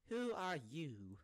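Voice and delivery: slow, high, stretched-out mock bafflement; drawn-out confusion. George Washington baffled by Barack Obama, drawn-out mock confusion. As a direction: drag out each word, hamming up the confusion. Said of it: "then he'd be so confused".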